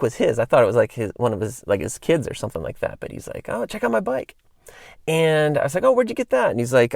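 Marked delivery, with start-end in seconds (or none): 3.5-4.4 lightly voiced proud buddy, much higher; a hint of a proud new rider showing off; Larry bragging. Larry shows Chris his new BMX bike, lightly voiced proud brag. 5.8-6.5 lightly voiced, much higher pitch; a touch of eager interest; mock curious question. Chris asks Larry where he got the bike, mildly eager and high. His own curious reply.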